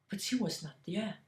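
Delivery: ordinary delivery, nothing marked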